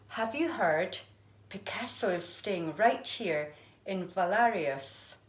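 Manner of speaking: lively storybook reading voice; reading to children. A narrator's reading. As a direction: read it like a picture book for a class. Teacher reads picture book aloud.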